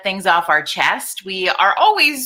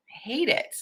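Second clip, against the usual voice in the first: muttered; 6 semitones higher, 8 dB quieter than usual. Acted. Grumbling after complaint.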